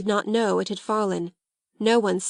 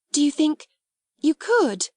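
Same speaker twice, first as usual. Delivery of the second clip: higher-pitched, hopeful question; eager exclamation; 7 semitones higher, 3 dB louder than usual. Acted. Hungry Sarah asks Ermengarde about fetching food, eagerly exclaiming. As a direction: ask with sudden hungry hope. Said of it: "she ejaculated".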